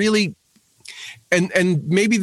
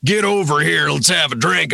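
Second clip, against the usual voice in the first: imitated Southern drawl. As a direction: put on a loose mock Southern drawl. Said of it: "it's all like that Southern drawl".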